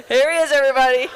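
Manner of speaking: loud, quick announcing burst; mock big introduction. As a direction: announce the star guest to the room, teasing. Mimics host presenting a surprise guest.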